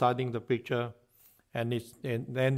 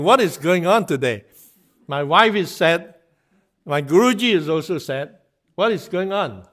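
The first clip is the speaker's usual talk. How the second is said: bewildered, louder; 4 semitones higher, 9 dB louder than usual. Acted. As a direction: throw up your hands: what is going on today. Krishna puzzled, everyone is sad.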